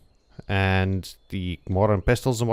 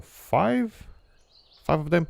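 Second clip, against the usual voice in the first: sudden high-pitched five; high squeak; 9 semitones higher, slower than usual. Real, not acted.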